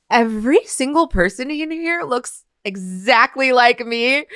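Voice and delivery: mock shocked aside; shocked realization. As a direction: realize with a jolt you all look alike. Shocked thought at auditions.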